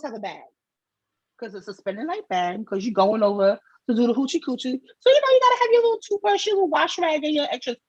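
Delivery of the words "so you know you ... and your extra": high, louder sing-song list; playful. Acted. Packing the overnight bag, high playful list. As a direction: tick off the little items excitedly.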